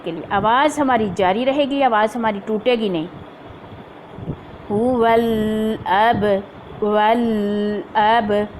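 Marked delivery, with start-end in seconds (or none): 4.7-8.5 हुवल with the ल held still before अब, very slow; held lam. Shown as a demonstration. Teacher demonstrating the held lam in हुवल अब. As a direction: hold the lam, then move to the hamza. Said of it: "लाम को hold रख के".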